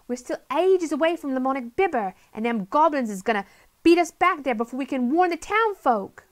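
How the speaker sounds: put-on fast, high, loud girl voice; urgent Polly. A narrator's reading. Polly warns Friday about the goblins, urgent and fast.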